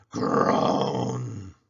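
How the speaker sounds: long drawn-out groan of pain; a crocodile groaning in pain; loud groan. A narrator's reading. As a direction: groan as if your jaw aches. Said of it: "crying real tears".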